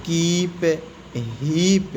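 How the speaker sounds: drawn-out ee in "कीप" and "हीप"; long ee. Shown as a demonstration. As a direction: hold the big ई in "कीप, हीप". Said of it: "बड़ी ई का उच्चारण". ("कीप, हीप" में बड़ी ई को लंबा खींचकर)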